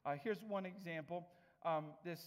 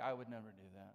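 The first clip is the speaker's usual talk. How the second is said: lower, prim denial in a lightly put-on voice; mock self-righteous listener; 6 semitones lower than usual. Imitating a listener denying anger, lower and prim. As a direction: mimic a smug churchgoer denying it. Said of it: "some of you are like".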